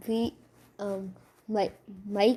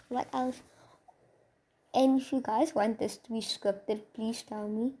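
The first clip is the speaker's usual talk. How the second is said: usual speaking voice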